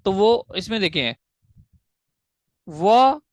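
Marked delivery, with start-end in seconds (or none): 2.7-3.2 वा alone as one drawn-out unbroken sound; single syllable. Shown as a demonstration. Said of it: "ये एक single uninterrupted sound है". (वा को एक अलग, अटूट आवाज़ में बोलें)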